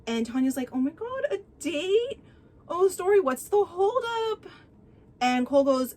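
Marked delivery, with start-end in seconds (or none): 0.7-4.4 a giddy high-pitched gossip voice; high gushing Tanya. Retold or imitated. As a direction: squeal it, high and breathless with delight. Tanya gushing over a date.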